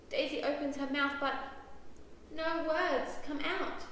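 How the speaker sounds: usual speaking voice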